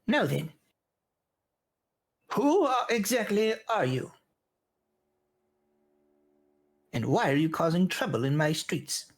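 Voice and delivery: high, stern gnome reprimand; huffing old gnome. Acted. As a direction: scold them while out of breath. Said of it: "He's like".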